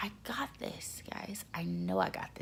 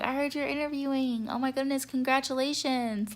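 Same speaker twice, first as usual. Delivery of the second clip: higher, gushing well-wishes; excited congratulations; 7 semitones higher than usual. Retold or imitated. Crew member congratulating an interviewee, excited and high. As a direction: imitate someone delighted for you. Acting another attendant's congratulations.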